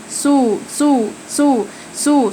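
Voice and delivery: سو said very slowly, each syllable drawn out and lingered on; slow Korean syllable. Shown as a demonstration. Teacher slowly repeating سو so students learn the Korean word for cow. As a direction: stretch سو slowly, like a pronunciation drill. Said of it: "بقرة يعني سو".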